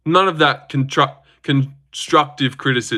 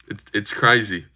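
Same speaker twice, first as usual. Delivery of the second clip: ordinary talk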